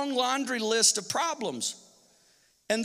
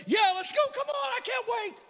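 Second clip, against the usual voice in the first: much higher, giddy squeal; giddy eager Caleb; 12 semitones higher, 3 dB quieter than usual. Pretend. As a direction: bounce with impatience. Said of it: "That was Caleb".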